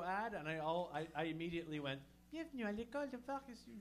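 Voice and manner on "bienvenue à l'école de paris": high-pitched silly Parisian French voice; ridiculous French guy. Retold or imitated. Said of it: "ridiculous French guy".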